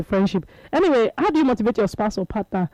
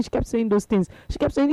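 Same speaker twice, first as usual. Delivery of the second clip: normal talking voice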